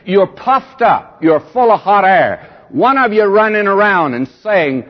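fervent rebuke. Acted. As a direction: scold the congregation from the pulpit. Preacher rebuking the Corinthians.

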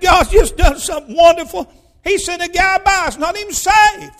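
high, loud exclaiming; excited testimony. Retold or imitated. Preacher retells joyful news to wife.

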